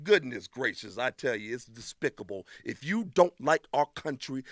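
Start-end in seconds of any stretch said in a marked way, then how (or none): none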